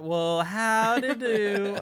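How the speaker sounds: loud, high, drawn-out mock hello; hammed-up greeting. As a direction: stretch out a silly character greeting. Mimicking film character's odd greeting.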